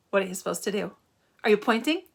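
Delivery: ordinary delivery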